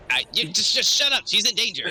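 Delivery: high, shrill snapping sprite; angry sprite. Acted. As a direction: snap at him to shut up. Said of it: "I'm a sprite".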